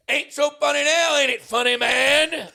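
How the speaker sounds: very high, very loud mock taunt; hammed-up taunting first baseman; taunting chirp. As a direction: jeer at the runner like a gloating first baseman. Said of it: "He goes".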